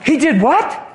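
hammed-up gossiping girlfriend stirring trouble; indignant girlfriend.

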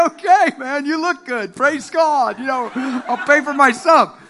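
high and laughing through the words; laughing reply. Retold or imitated. Preacher answering the cashier, laughing. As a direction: laugh along while answering. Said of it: "I said".

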